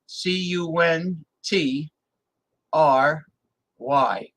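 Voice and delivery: spelling out C U N T R Y letter by letter, slow; slow spelling of country, C U N T R Y. Shown as a demonstration.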